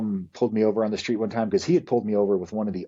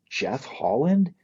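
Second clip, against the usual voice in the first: amazed recognition; 6 semitones higher, much slower than usual. Retold or imitated. Cop recognizes him, amazed.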